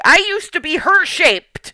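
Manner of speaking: loud, high, drawn-out protest; indignant outburst. Acted. Pointing at another character to compare shapes, loudly indignant. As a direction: blurt it out loudly in exasperation.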